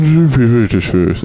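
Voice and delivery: a lightly put-on British accent; mock British voice. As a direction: say it as a droopy Brit. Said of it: "when you were doing the British voice".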